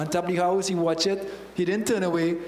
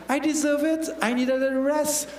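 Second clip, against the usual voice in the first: mock excuse; 6 semitones higher than usual. Preacher voices sin's excuse.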